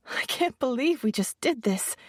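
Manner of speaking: half-laughing at her own nerve; newlywed bride, nervous and smiling; smiling nervously. A narrator's reading. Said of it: "smiling nervously".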